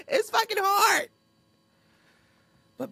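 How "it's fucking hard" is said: shouted repeat. Real, not acted. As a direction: blurt it out as a laughing outburst. Cursing confession, shouted repeat.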